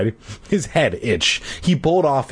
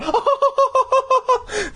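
high mocking class laughter; class laughing. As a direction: burst out laughing at him. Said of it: "Everyone laughed a lot louder".

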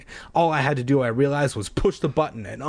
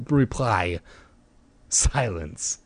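ordinary delivery, nothing marked

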